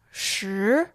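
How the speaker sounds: Shi rising upward; second tone. Shown as a demonstration. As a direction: let Shi climb. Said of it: "Here are the four tones".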